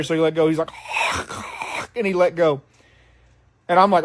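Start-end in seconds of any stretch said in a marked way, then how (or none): 0.8-1.9 imitated choking gasps; rasping, voiceless gasps for breath; gasping for air. Drunk man punched in the throat, imitated gasping for air. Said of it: "gasping for air".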